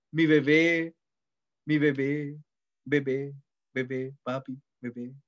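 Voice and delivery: a hint of lovers' baby talk, dying away; mock cooing couple. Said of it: "algo que a mí me llama la atención mucho de las parejas jóvenes".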